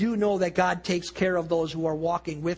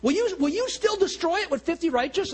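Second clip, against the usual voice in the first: pleading Abraham; 8 semitones higher than usual. Retold or imitated. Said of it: "he pleads with them".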